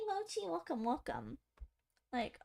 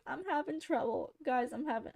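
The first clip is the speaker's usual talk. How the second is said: usual speaking voice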